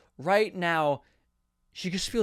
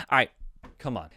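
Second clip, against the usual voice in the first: usual speaking voice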